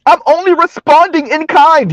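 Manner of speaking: high-pitched mimicked excuse; mock protest. Host mimicking women defending their rhetoric, mock protest.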